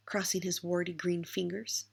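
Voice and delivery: ordinary delivery, nothing marked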